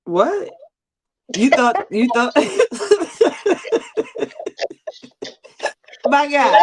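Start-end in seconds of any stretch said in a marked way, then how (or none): 2.4-4.9 high laugh. Real, not acted. Laughing at the misread.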